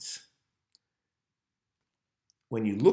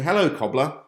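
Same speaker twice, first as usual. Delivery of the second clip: loud, raised Cockney greeting; Cockney accent; 6 semitones higher, 8 dB louder than usual. Acted. Onlookers hailing the man in the shop, in a Cockney accent. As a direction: sing out a put-on Cockney hello. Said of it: "speaking in a cool Cockney ac-, accent".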